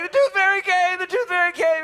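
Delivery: put-on high, loud thrilled little girl; a kid bursting with news at breakfast; excited child. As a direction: daughter announcing the tooth fairy came. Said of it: "she's like".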